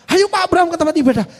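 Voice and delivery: high, quick, hurrying call; imitated calling out. Worship team hurries preacher back to service, imitated shout. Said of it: "teriak itu dari ruang ibadah".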